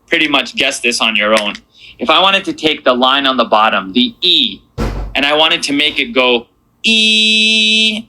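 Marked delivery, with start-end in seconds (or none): 5.1-8.0 E held long and steady, higher; stretched E. Shown as a demonstration. Teacher stretching the vowel for students. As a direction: draw the E out for two counts. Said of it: "stretch that sound out".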